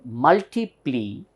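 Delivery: "multiplication" split up, with "ca" punched and long; light weight on "multi", heavy on "ca" in "multiplication". Shown as a demonstration. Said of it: "there is a lower stress".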